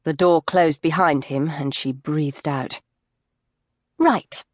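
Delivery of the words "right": a light, breezy "Right"; said lightly. A narrator's reading. Chloe lightly wrapping up the fitting, breezy. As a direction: toss it off lightly to move things on. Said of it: "she said lightly".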